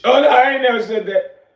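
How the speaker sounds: loud, high mock protest; mock denial. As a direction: ham up the kid backing out. Mocking the kid's denial.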